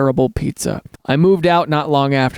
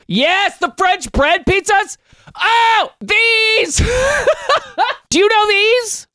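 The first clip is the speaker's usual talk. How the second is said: high, thrilled squeals; excited squeal; much slower than usual. Real, not acted. Gushing over pizza boats.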